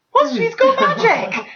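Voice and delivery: high, slow squeal of fake amazement; mock shocked squeal. Mocking the film, high incredulous squeal.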